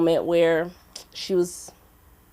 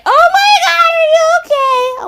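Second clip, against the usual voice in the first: very high, loud wailing concern; mock panicked shriek; 7 dB louder than usual. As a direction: shriek it like a hysterical sister. Acts sister rushing out alarmed.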